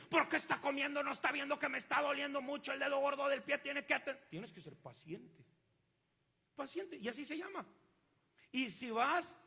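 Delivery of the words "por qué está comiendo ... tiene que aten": louder, higher indignant griping; hammed-up angry patient; impatient complaint. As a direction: fuss at the doctor like a pained, impatient patient.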